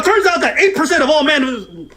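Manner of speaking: high-pitched imitation of blurting statistics; lightly hammed-up know-it-all interruption; mock stat-spouting host. Mimics host reeling off stats.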